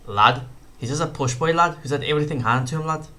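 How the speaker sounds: put-on second stab at a Scouse fighter's accent, still poor; clumsy Scouse imitation; Paddy Pimblett accent. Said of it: "That's terrible as well".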